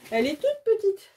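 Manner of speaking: high, squeaky little coo; laugh, then squeal it as if over something tiny; gushing over cuteness. Real, not acted. Cooing over a tiny canvas.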